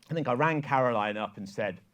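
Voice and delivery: ordinary delivery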